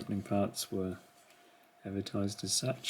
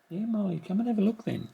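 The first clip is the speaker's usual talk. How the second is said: much higher, sing-song coaxing to a pet; talking to the cat; 12 semitones higher, faster than usual. Real, not acted. Owner calling his cat Molly over, high coaxing pet voice. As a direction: lift the voice way up to coax the cat.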